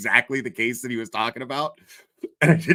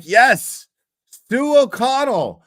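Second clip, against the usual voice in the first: sarcastic exasperation; 10 semitones higher, 6 dB louder, slower than usual. Acted. Mocking a commentator on stream.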